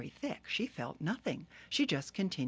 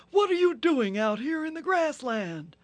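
high, loud, cheery rhino; friendly Rhino; 10 semitones higher, 8 dB louder than usual. Acted. Rhino waking and greeting Turtle, loud and cheery. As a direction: greet her like a big jolly rhino.

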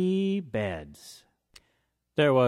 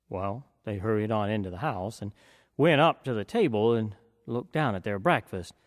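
ordinary delivery

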